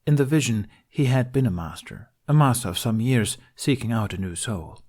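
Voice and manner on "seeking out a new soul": plain reading voice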